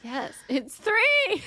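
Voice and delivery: excited. Real, not acted. Triumphant correction in argument.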